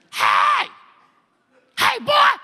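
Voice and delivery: high, forceful holler; loud yell. Retold or imitated. Inmate calling to the new arrival, high loud holler. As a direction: bellow it to get his attention. Inmate yelling from his cell.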